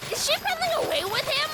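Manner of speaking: high, alarmed gasp of a question; a mother's sudden alarm; shocked mom. Pretend. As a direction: catch her running off. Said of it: "point of view of this lady".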